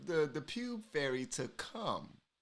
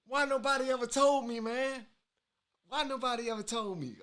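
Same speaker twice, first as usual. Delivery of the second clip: excited; 8 semitones higher, 6 dB louder than usual. Real, not acted. Amazed reaction to the clip.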